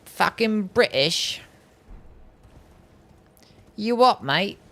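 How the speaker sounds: slow, jeering British "mate" voice; mock British accent. Answering chat's British jab in mock British accent. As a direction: playfully ham up a British bloke. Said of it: "sound British?".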